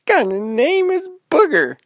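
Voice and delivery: high, loud disbelieving squeal; girl baffled by a silly name; incredulous. Acted. Girl amazed at bear's name.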